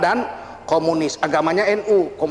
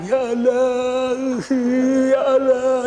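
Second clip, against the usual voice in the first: slow, drawn-out tearful plea to God; mock a preacher crying out in prayer; a hammed-up sobbing prayer voice; imitating a weeping preacher; 5 semitones higher, much slower than usual. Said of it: "tukang nangis".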